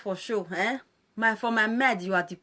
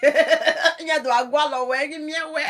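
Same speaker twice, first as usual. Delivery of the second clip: laughing; 4 semitones higher, 6 dB louder than usual. Real, not acted. Laughing mockery at caller.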